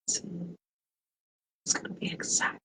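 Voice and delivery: secretive whisper, barely voiced; hushed, conspiratorial whisper of exciting news. Shown as a demonstration. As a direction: lean in and whisper the tease. Teasing news, repeats as whisper.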